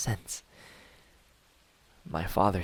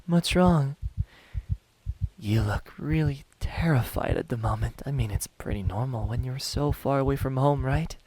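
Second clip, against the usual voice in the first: higher, taunting false sympathy; mock concern; 5 semitones higher, 4 dB louder than usual. Hunter toying with a terrified captive, mock concern. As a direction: feign concern, pitched up and teasing.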